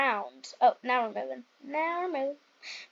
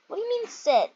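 ordinary talk

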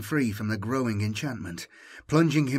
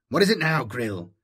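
irritated commander; 4 dB louder than usual. Acted. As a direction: bark out the impatience.

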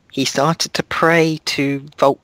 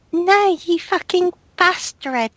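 much higher mock-outraged cry; hammed-up shriek. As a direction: shriek it like a melodramatic heroine. Acted quote while reading fanfic.

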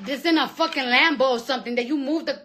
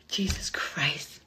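slow, exhausted whisper; slow whispered despair; weary whisper; 9 semitones lower, 10 dB quieter, much slower than usual. Real, not acted. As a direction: drag it out in a whisper. Sighing over the virus.

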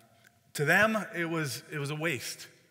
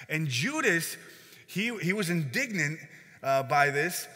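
normal talking voice

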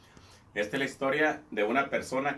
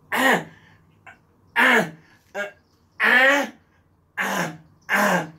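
much higher, louder wordless grunts; imitated mute grunting; 7 semitones higher, 8 dB louder, much slower than usual. Scared bartender imitating the mutes for his boss.

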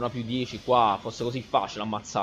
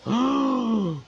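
long high exasperated gasp; sussulto esasperato; 10 semitones higher than usual. Real, not acted. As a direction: suck in air in high-pitched disbelief. Missed hit, about to rage. (sussulto acuto di esasperazione)

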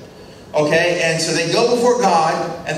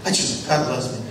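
ordinary delivery

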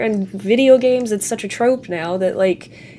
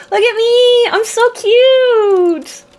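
squeaky high, slow and loud, all cuteness; cutesy high voice; 13 semitones higher, 6 dB louder, much slower than usual. Pretend. Player character showing off to the town, high cute squeal. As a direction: show off like a tiny adorable creature.

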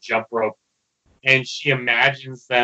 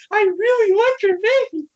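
much higher, squeaky filly voice; squeaky spellbound pony. Retold or imitated. Sweetie Belle under the spell, squeaking much higher. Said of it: "she's like".

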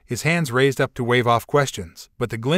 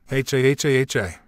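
spelled-out mock villain laugh; a kid's hammy evil laugh. Child playing villain laughs.

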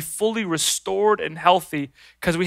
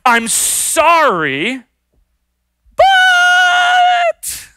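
hedged apology; much slower than usual. Retold or imitated. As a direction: stretch SORRY, pause, then wail BUT. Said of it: "Or maybe they do this".

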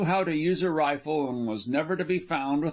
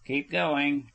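usual speaking voice

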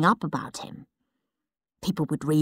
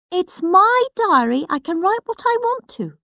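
much higher, prim little brother voice; indignant Peter; 11 semitones higher than usual. Acted. Peter ordering Henry out of his bedroom, high and prim. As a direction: shoo him out, high and prissy. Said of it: "said Peter".

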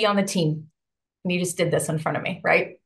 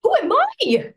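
high-pitched, incredulous self-questioning; retold self-doubt; 10 semitones higher, 3 dB louder than usual. Newbie doubting herself before knocking, high-pitched disbelief. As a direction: voice leaps up in mock disbelief. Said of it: "I was like".

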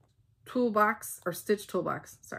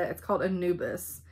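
ordinary talk

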